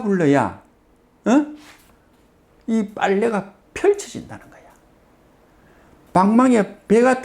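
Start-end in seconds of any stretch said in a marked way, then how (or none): none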